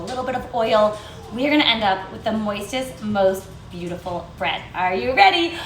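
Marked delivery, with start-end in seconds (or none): none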